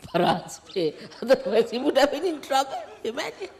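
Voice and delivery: amused disbelief spilling into laughter; laughing. Real, not acted. Laughing at the irony.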